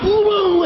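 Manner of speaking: high, loud crazed whoops; manic whooping. Retold or imitated. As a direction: whoop like you've gone nuts. Re-enacting his manic screamed audition.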